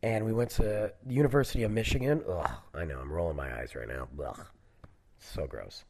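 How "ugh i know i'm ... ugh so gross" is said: normal talking voice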